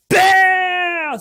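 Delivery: loud, high booing; jeering boo at the screen. Real, not acted. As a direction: boo the villain hard. Booing the villain.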